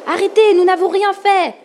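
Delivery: high, loud cry of protest; pleading shout. A narrator's reading. Boy shielding his sister from the wave, high shout. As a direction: shout up at an attacker, pleading innocence.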